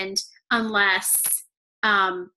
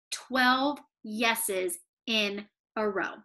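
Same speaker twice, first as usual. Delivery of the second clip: slow reveal; 2 semitones lower, 5 dB quieter, much slower than usual. Real, not acted. Punchline reveal.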